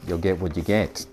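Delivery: ordinary delivery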